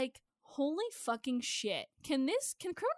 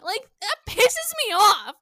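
annoyed; 7 semitones higher, 10 dB louder than usual. Real, not acted. Ranting about episode titles.

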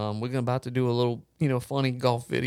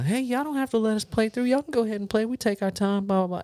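lightly voiced friendly older golfer, higher pitch; a hint of imitation, pitched up; old couple; 9 semitones higher than usual. Old couple tells golfers to play on, lightly imitated higher voice. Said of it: "Old couple comes up behind us".

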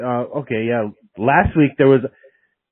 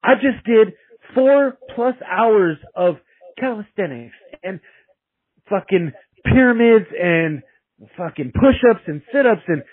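exasperated venting; 6 semitones higher than usual. Retold or imitated. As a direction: pile up the misery, half laughing at it. Recalling exhausted frustration.

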